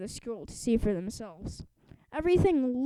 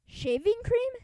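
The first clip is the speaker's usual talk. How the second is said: surprised; 11 semitones higher than usual. Acted. Kipper learns the truth.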